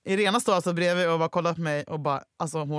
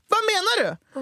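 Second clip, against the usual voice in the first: mock high; 11 semitones higher than usual. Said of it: "Du bara, tar tag i min axel och bara".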